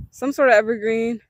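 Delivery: usual speaking voice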